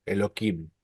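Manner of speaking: hard K in the middle of Elohim; H changed to K. Shown as a demonstration. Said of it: "algunos dicen así".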